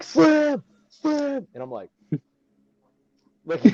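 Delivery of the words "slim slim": high, loud call across the arena; yelling a buddy's nickname from the crowd. Retold or imitated. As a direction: shout his name to get his attention. Friend calls from front row.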